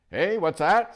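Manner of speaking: put-on high, loud cranky old dwarf; deaf old dwarf. Dwarf cupping his ear at the owl, loud and cranky. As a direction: bark it like a deaf old man.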